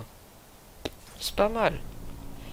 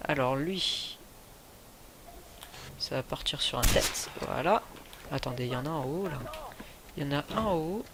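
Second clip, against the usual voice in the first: hushed play-by-play; 8 dB quieter than usual. Acted. Quiet sniping play-by-play.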